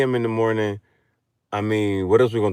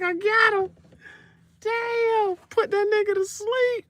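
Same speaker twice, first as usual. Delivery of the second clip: much higher triumphant squeal; mock woman's voice. She brags after the act, high squeal. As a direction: squeal the win. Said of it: "She was like".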